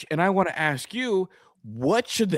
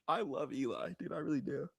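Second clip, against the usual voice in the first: much softer, a hushed heartfelt confession; emotional; 2 semitones lower, 13 dB quieter than usual. Real, not acted. Cohost is overcome with love for Eli, hushed and emotional. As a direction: say it softly, overcome with fond emotion. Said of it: "sorry, I'm emotional right now".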